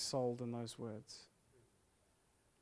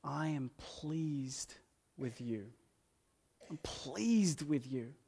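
soft, slow, gentle reassurance; hushed, unhurried divine comfort; tender voice of God; 4 dB quieter, much slower than usual. Acted. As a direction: speak it slowly like a loving father. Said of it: "God says".

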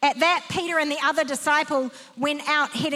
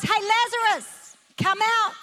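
mild raised call, higher pitch; 6 semitones higher than usual. Retold or imitated. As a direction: call out as if summoning someone from a tomb. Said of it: "called out in a loud voice".

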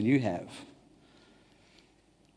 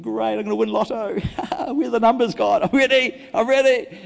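eager, laughing lotto daydream, rising higher; mock excited; 8 semitones higher, 5 dB louder than usual. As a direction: ham up the greedy hope, ready to win. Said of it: "I'm thinking".

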